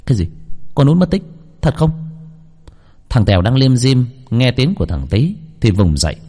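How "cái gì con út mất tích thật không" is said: higher, startled, can't believe it; shocked disbelief. Acted. Boy hearing his friend vanished, shocked disbelief. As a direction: jolt up hearing the news. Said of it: "đôi mắt trợn như không thể tin nổi".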